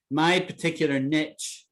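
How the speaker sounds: "niche" said as "nitch"; variant pronunciation. Shown as a demonstration. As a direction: say "niche" to rhyme with "rich". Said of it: "Some people pronounce it niche".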